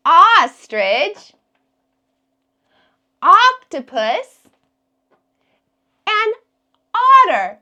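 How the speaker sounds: Ostrich and octopus said loud and slow; emphatic word list. Shown as a demonstration. Teacher presenting words for the kids to guess. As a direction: hold up each word loudly. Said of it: "comes at the beginning of all three".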